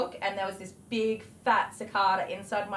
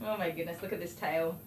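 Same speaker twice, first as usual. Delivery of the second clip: usual speaking voice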